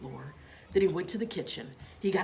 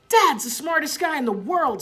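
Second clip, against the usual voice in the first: put-on higher, louder boyish praise; excited; 10 semitones higher, 9 dB louder than usual. A narrator's reading. Boy praises his dad, excited. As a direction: brag proudly about dad. Children cheer.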